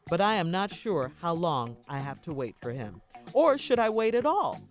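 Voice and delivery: normal talking voice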